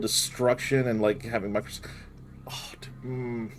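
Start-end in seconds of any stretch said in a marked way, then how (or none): 2.5-3.5 whispered awe. Real, not acted. Awed under breath.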